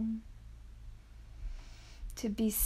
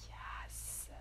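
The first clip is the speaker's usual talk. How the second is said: barely audible, drawn-out whisper; hushed inward affirmation; whispered yes; 18 dB quieter than usual. Real, not acted. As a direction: breathe the word out in a whisper. Whispered yes inside the meditation.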